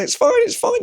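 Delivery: mock strained insisting, pitched right up. Shown as a demonstration. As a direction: protest it's fine as the voice climbs up and up. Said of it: "your voice is getting higher".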